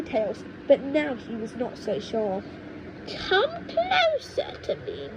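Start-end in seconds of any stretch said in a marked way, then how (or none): 3.1-5.1 very high, slow coaxing croon; witch grandma beckoning. Acted. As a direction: lure the boy in like a witch. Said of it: "beckoning him with a horny finger".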